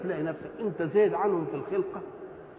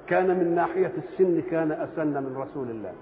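ordinary delivery

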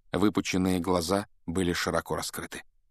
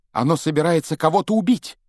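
loud, higher alarmed cry; shout; 8 semitones higher, 6 dB louder than usual. Acted. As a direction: yell the warning. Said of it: "крикнул он".

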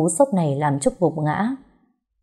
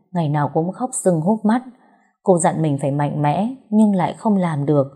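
plain reading voice

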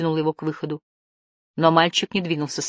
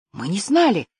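higher, hesitant, stunned reply; stunned admission; 4 semitones higher than usual. A narrator's reading. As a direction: admit it in taken-aback disbelief. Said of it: "выдохнул Лиф". (растерянно, поражённо)

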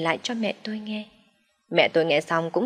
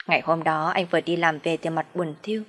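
ordinary delivery

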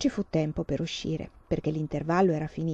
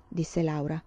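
ordinary delivery

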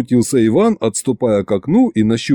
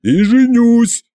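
higher, louder, slow yell; hammed-up desperate yell; 12 semitones higher, 4 dB louder, much slower than usual. A narrator's reading. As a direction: yell back defiantly. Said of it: "завопил в ответ губернатор".

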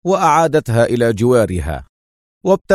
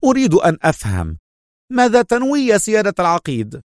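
high-pitched, keenly curious woman; interested; 7 semitones higher than usual. A narrator's reading. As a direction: press him for the plan, pitch lifted. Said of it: "وسألته في اهتمام". (باهتمام وصوت نسائي مرتفع)

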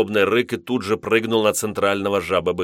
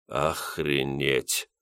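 lower, softer, slow stunned exhale; 6 semitones lower, 7 dB quieter, much slower than usual. A narrator's reading. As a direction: drop it, floored by the news. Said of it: "потрясённый такой новостью".

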